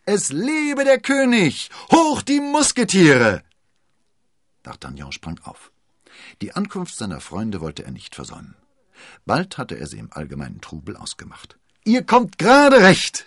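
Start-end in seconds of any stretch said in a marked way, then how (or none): 0.1-3.4 high, drawn-out jubilant shout; loud cheering. A narrator's reading. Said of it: "lauter Jubel ertönte". 11.8-13.2 high, loud, joyful welcome; beaming. A narrator's reading. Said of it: "strahlte er".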